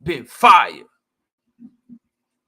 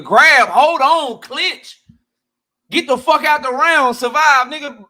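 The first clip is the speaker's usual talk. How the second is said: loud, high urgent coaching shouts; corner yelling; 8 semitones higher, 6 dB louder, slower than usual. Retold or imitated. Cornerman shouting at his hurt fighter, loud and urgent. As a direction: bark it like a cornerman mid-round.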